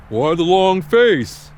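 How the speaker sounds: lightly voiced dad; imitating dad. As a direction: drag it out like a nosy dad. Dad asks.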